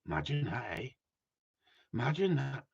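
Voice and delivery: hushed whispered aside. Real, not acted. Whispering to the viewers in mock disbelief. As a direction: breathe it out in a whisper.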